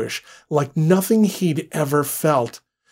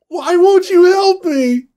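very high, loud cracking plea; 8 dB louder than usual. Acted. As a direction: let the voice break. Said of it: "Her voice cracked".

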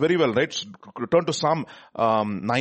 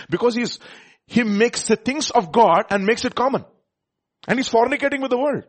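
raised, emphatic reading of a warning; insistent preacher, pitched up; forceful scripture preaching; 7 semitones higher, 4 dB louder than usual. Real, not acted. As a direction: drive the verse home to the congregation. Reads and expounds scripture forcefully.

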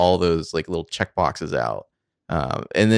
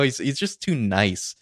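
ordinary talk

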